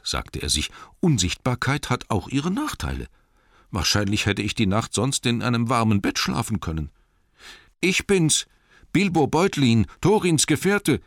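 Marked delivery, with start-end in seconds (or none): 1.0-6.9 higher hobbit voice, mildly rueful; lightly voiced Bilbo, grumbling. Bilbo regretting his invisibility, mildly grumbling to himself. 7.8-11.0 high, loud announcement of himself; calling out. A narrator's reading. Hidden Bilbo making himself known to the man, calling out. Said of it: "rief er".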